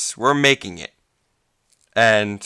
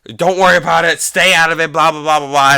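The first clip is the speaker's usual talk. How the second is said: loud, higher mock of a mother's warning; nagging mother; 6 semitones higher, 6 dB louder than usual. Speaker imitates mother warning them to stay out, nagging. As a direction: hurry off mom's advice into loud blah-blahs. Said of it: "my mother will say".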